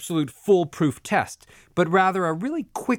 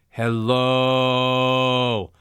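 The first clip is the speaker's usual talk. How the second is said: low held hello. Shown as a demonstration. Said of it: "as you say that".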